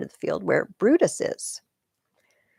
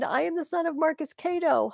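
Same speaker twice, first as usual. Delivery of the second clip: defiant shout; 10 semitones higher than usual. Acted. Cato's line read in the play.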